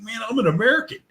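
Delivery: high-pitched, incredulous mock complaint; mock protest. American guest demanding cold beer, retold mock protest.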